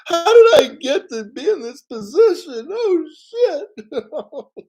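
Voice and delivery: high, loud hammed-up wail; mock crying. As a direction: put on an overwhelmed, sobbing cry. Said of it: "I just wanna start crying".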